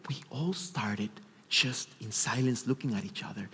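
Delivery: an unvoiced whisper. Real, not acted. Recalling the silent moment, whispered. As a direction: whisper it like it's sacred.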